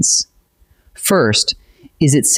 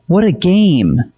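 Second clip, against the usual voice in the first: higher, drawn-out exclamation; bursting excitement; 5 semitones higher, slower than usual. Shown as a demonstration. Teacher demonstrating the exclamation point, excited. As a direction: let excitement burst out on a slow, raised exclamation. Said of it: "That's excitement bursting out".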